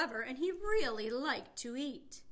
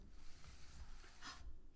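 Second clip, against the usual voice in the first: gasp; 13 dB quieter than usual. Acted. Pete vanishes.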